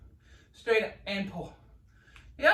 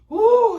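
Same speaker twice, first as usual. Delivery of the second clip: high whoop; 10 semitones higher, 11 dB louder than usual. Real, not acted. Cheering through the burning muscles.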